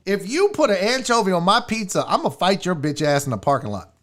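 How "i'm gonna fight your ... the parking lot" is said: ordinary talk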